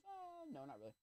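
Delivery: high, soft mock voice; imitating the guy. Guy next to me downplays the heat, high mock voice. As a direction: squeak it out casually. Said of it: "And he goes".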